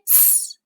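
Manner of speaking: "S" as a long voiceless hiss; S sound. Shown as a demonstration. Teacher models the sound to practice, "S" hissed long. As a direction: hold "S" as a pure hiss, no vowel. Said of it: "Let's practice saying the S sound".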